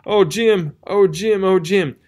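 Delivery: a touch of stammering dismay; stammered. A narrator's reading. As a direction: stammer it out in shock. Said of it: "He stuttered and stammered".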